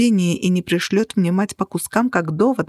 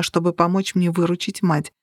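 ordinary delivery

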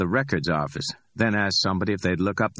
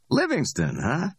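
higher, amused street hustler voice; cocky Ordell; 10 semitones higher than usual. Acted. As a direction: play Ordell, high and pleased with himself.

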